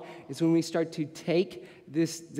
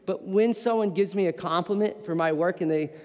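ordinary talk, nothing marked

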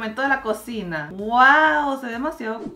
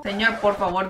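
normal talking voice

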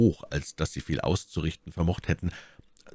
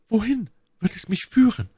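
very high falsetto lady's question; inquiring My Lady; 3 dB louder than usual. A narrator's reading. Lady questioning her rescuer, high-pitched curiosity. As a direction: ask in a high, put-on lady voice.

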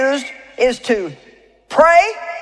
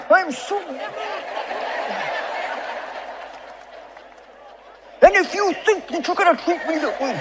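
mock mad; 5 semitones higher, 8 dB quieter than usual. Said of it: "Do I look mad?".

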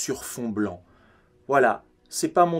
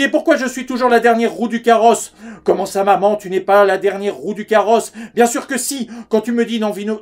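lightly voiced family argument, hurried back-and-forth; [name] replaying a quarrel; 7 semitones higher, 4 dB louder, faster than usual. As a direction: switch quickly between aggrieved mother, son and fiancée. ([name] rejoue la dispute, légèrement agité)

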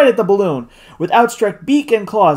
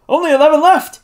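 excited call; 11 semitones higher, 5 dB louder, faster than usual. A narrator's reading. Sportsman's call amid shooting at birds.

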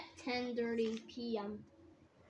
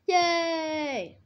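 loud, higher cheer; shouting; 5 semitones higher, 5 dB louder than usual. A narrator's reading. Hank cheers in the story, shouting. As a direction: shout a drawn-out hooray. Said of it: "said Hank, shouting".

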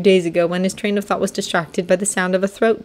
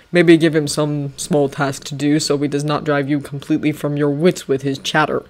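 lower, measured commanding general; General Washington; 3 semitones lower than usual. Acted. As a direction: speak like a calm stern general. Washington voiced in story.

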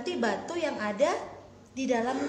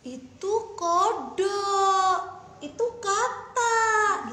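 slow, higher whimpering maid; crying maid explains; 5 semitones higher, 4 dB louder, much slower than usual. Acted. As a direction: drag it out as a flustered servant. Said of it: "Kata ibu pelayan".

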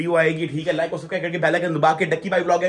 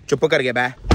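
usual speaking voice

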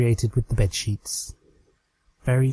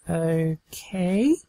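slow; 7 semitones higher than usual. Acted. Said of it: "said Trixie slowly".

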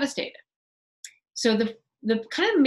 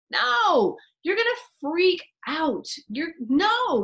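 emphatic no; 8 semitones higher, 7 dB louder, much slower than usual. Real, not acted. Emphatic rebuttal to audience.

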